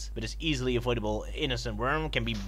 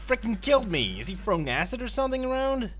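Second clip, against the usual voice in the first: mildly outraged, pitched up; indignant; 8 semitones higher than usual. Acted. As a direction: protest the attack in a higher voice. Reacts to dishwater thrown at him.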